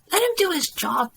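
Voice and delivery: high-pitched protest; protesting son. A narrator's reading. Son defends the waiter to his mother, high-pitched protest. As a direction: stand up for him in a high voice.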